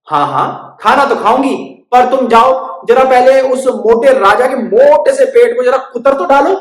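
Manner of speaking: high, loud scheming bird; bossy bird. Acted. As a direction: order it slyly. Bird demands mouse bite king.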